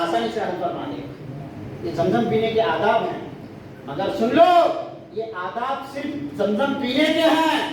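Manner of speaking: ordinary talk, nothing marked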